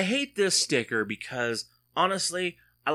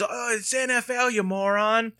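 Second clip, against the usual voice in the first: high, sharp mock of an irritated driver; imitating the annoyed woman; 11 semitones higher, 4 dB louder than usual. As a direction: snap back as the fed-up lady, exaggerated.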